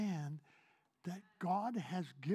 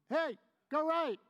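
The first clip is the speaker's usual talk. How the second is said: higher, louder shouted call; mock yell; 8 semitones higher, 6 dB louder than usual. Preacher mimicking God yelling from the clouds, mock shout. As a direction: bark it like a voice from the clouds. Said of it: "yell at you".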